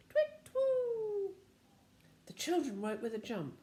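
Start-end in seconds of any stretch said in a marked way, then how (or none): 0.1-1.3 high owl hoot. Shown as a demonstration. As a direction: do the owl noise. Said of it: "Can you do the noise of an owl?".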